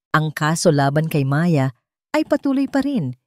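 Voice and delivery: plain reading voice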